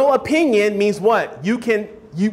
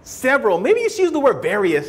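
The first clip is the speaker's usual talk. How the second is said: higher, hesitant nitpicking teacher voice; mock feedback in a higher, hedging voice; mocking a fussy teacher; 5 semitones higher than usual. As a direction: mimic a teacher's wishy-washy advice, pitched up. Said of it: "They give you that kind of nonsense".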